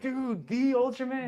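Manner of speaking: high, slow, drawn-out amazement; awed fan excitement. Retold or imitated. Actor with his Ultraman figures, awestruck. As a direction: stretch it out, high and thrilled.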